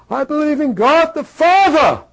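high, full-voiced acted shout. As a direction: shout the creed at the top of your voice. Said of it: "Louder, man".

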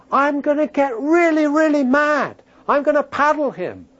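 high-pitched, worked-up mock fury; acted parental anger. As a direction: play the parent furious out of love. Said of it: "That's why I'm so angry".

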